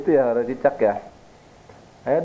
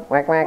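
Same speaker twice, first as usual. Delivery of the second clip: high wek wek duck call; duck quack; 9 semitones higher than usual. Shown as a demonstration. Mother quacks for her son to guess, high duck sound. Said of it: "Suara apa, Altan?".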